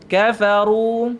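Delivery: kafaru slowly with pouted ru; thick ru. Shown as a demonstration. Teacher models kafaru for the students. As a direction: draw out kafaru, lips forward on ru. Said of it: "Muncul mulut".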